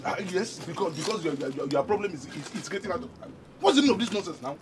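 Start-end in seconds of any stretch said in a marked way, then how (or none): 3.6-4.6 raised, exasperated demand; angry yelling. Acted. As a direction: yell it in irritation, pitched up. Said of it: "Why are you yelling?".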